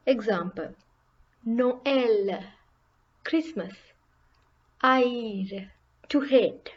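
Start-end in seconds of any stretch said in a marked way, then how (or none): 1.5-2.4 "Noël" as two syllables, no-EL; both vowels pronounced. Shown as a demonstration. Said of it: "both need to be pronounced". 4.8-5.7 "Haïr" as two syllables, a-EER, silent h; both vowels pronounced. Shown as a demonstration. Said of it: "both need to be pronounced".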